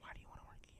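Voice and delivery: very soft whispered imitation; hushed, voiceless impression of an interviewer; whispering interviewer. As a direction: mimic her in a barely audible whisper. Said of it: "she's talking like this".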